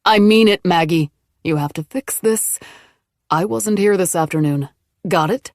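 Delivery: low angry voice. A narrator's reading. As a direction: threaten her coldly. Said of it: "one low, angry voice".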